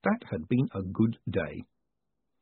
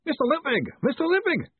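high, urgent call, not full volume; yelling. Acted. Newcomer at the doorway calling Moist, high-pitched yell. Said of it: "someone yelled".

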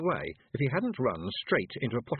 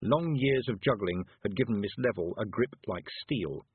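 plain reading voice

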